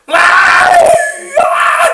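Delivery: very loud, high-pitched mock bawling; sudden loud childish scream; imitated child's wail. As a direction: wail like an upset kid, high and full-out. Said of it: "막 이러는 거야".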